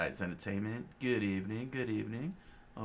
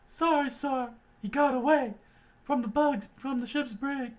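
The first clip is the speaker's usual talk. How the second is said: much higher, louder squeaky report; panicked marine; 7 dB louder than usual. Acted. Marine reporting an escape to his lieutenant, high and panicked. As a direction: blurt the bad news in a squeak.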